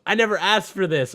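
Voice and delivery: high, loud imitated protest; hammed-up high complaint; Ellie. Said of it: "where Ellie goes".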